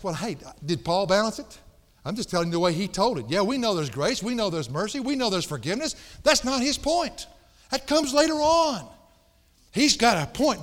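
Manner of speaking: high, loud shouted warning to the strong; preaching at full force. Acted. Preacher shouting a warning to the strong ones in church. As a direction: hammer it home like a revival preacher. Preacher answers objection, rising intensity.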